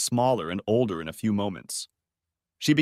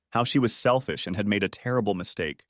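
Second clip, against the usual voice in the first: ordinary delivery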